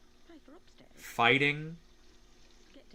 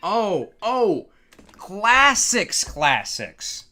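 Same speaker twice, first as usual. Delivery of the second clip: surprised; 4 semitones higher, 7 dB louder, much slower than usual. Real, not acted. Realizing what classics means.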